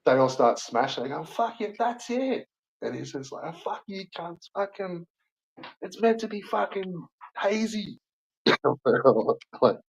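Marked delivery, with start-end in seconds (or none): 1.2-2.5 high-pitched scoffing crowd voice; mock fed-up punters. Crowd giving up on beer. 3.5-8.0 high, soft mock outrage; mock indignant owner. Owner swearing at customers.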